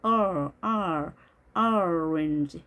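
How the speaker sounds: drawn-out first vowel of "orange", midway between o and a. Shown as a demonstration. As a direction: isolate the "or" sound, then say "orange". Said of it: "오와 아의 중간 소리입니다". (orange의 첫소리를 오와 아의 중간으로)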